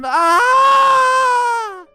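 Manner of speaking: long high shriek of terror; falling scream. Acted. Bride screaming as she falls from the cliff, long shriek.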